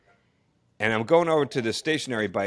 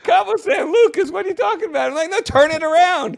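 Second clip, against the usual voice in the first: much higher and louder, can't believe it; incredulous outburst; 7 dB louder than usual. Retold or imitated. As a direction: protest the news in loud disbelief. Disbelief at station's position.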